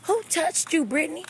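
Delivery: high, urgent questioning; acted-out alarm, demanding an answer; blowing up. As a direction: press the friend for a name. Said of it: "I blew that bathroom up".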